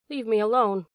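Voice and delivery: understated weary plea, falling at the end; quietly imploring. A narrator's reading. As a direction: plead mildly, disheartened. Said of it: "He spoke quietly and imploringly".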